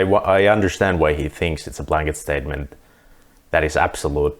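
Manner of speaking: ordinary delivery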